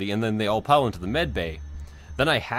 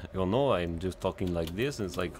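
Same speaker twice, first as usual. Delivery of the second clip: a soft, lower vaguely French accent; vaguely European accent; 5 semitones lower, 7 dB quieter than usual. Shown as a demonstration. Gamer demoing her one accent to her friend, vaguely French. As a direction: show off your all-purpose European accent. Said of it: "No, that sounds French".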